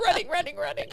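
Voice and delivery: high, laughing through the words. Real, not acted. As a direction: laugh while you say it. Laughing at the picture.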